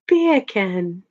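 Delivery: amused mock British "Beer can"; British "Beer can" with no R, like Jamaican "bacon"; British accent. Shown as a demonstration. Streamer amused by own accent joke, British accent. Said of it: "with a British accent".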